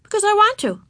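put-on high child retort; defiant little girl. A narrator's reading. Ramona answers her sister's question, defiant high child voice. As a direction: answer back like a stubborn kid.

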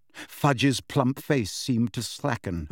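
usual speaking voice

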